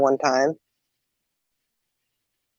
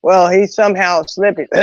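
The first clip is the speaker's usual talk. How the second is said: ordinary talk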